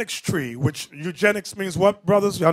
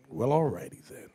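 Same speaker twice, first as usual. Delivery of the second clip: "Well, alrighty then" repeated much softer, like an aside; softer echo; 5 semitones lower, 9 dB quieter than usual. Shown as a demonstration. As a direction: mutter the catchphrase back under your breath.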